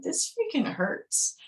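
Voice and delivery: high, slow honest wince; honest complaint. Retold or imitated. Showing the honest answer instead of acting tough, high and drawn out. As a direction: stretch it out, honestly hurt. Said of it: "instead of just being like".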